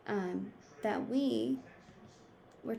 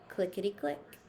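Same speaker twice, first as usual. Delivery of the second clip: dry clicking word; rattling reeds. Acted. Sound word in picture book.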